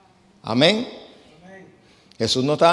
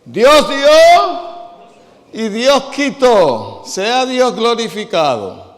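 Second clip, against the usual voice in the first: much higher, much louder, very slow pompous pulpit intonation; hammed-up solemn preacher chant, far higher and louder; mocking funeral minister; 10 semitones higher, 11 dB louder, much slower than usual. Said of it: "lo primero que dicen es".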